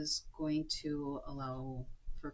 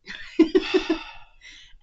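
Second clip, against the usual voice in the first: laughing; 13 semitones higher, 11 dB louder than usual. Real, not acted. Laughing at funeral prank idea.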